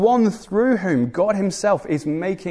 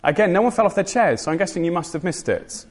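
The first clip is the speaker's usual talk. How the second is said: ordinary delivery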